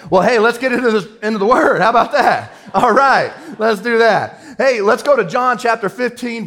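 can barely contain the excitement; excited. Real, not acted. Pastor launching into the word, thrilled and high.

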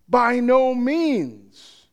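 By no means, slow and firm; emphatic denial. Retold or imitated. Preacher voicing Paul's refusal, slow and emphatic. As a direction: stretch out By no means, each word firm. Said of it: "with the emphatic "no way".

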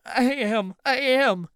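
high, tearful protest. A narrator's reading. Anthony fumbles to open the locked door, tearful. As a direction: plead as if you've been crying. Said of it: "sounding like he's been crying".

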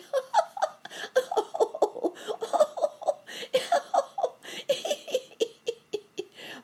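long high-pitched giggling fit; high, merry cackling; hard cricket laughter. A narrator's reading. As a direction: laugh helplessly in rising squeaky bursts. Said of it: "how hard he laughed".

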